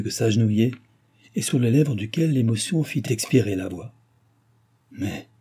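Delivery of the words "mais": soft, hesitant murmur; small hushed protest. A narrator's reading. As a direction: murmur it, barely voicing the objection. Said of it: "murmura le baron".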